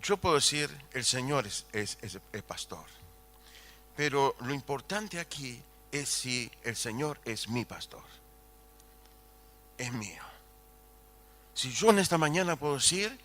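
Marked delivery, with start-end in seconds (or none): 0.9-10.2 softer, hushed and personal; intimate preaching. Acted. Preacher to congregation, quietly making the psalm personal. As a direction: bring it down to a near confession.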